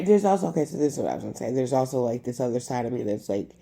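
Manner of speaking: ordinary delivery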